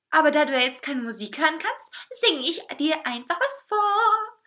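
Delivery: high, lilting cake voice; high, gleeful cartoon food voice; cheeky cake. A narrator's reading. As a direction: announce it showily, stretching the last words. Cake announces a song gleefully.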